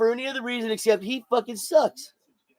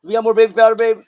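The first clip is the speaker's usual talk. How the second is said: raised voice calling across the room; 7 dB louder than usual. Real, not acted. Calling to his partner in another room for baby powder, loud call.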